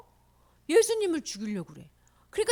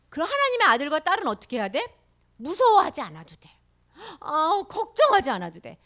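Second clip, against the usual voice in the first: normal talking voice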